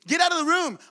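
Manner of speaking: high, loud order to leave; shouted command. Acted. Joseph clearing the Egyptians from the room, shouted command.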